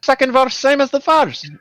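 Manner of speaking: high, hammed-up Scottish accent. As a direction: Alexander Anderson in a Scottish accent. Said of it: "do a Scottish accent".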